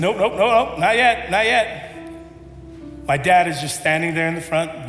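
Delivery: usual speaking voice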